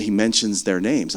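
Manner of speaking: normal talking voice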